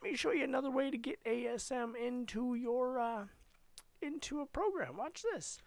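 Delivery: high, squeaky demonstrator; silly high-voiced explanation; put-on high voice. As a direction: pitch it way up, playfully.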